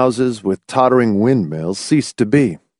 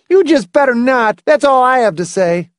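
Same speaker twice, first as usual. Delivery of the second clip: put-on high, louder boyish defiance; belligerent boy; 5 dB louder than usual. A narrator's reading. Boy warning against abolishing him, high belligerent voice. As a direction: warn them off like a fierce boy.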